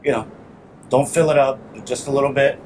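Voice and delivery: usual speaking voice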